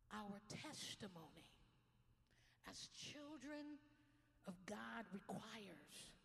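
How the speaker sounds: very slow, measured words with long pauses; slow sermon reading. Acted. Pastor reading the slide slowly to the congregation. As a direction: linger on every phrase.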